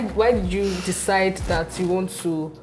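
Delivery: ordinary delivery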